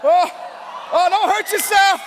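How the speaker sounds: high, loud laughing cry as people rush up; laughing shout. Real, not acted. People rushing to the stage.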